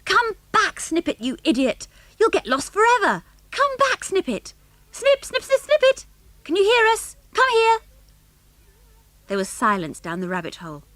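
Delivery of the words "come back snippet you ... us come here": very high calls to a lost dog; yelling. A narrator's reading. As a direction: call urgently down a hole. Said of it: "bent down by the hole and yelled".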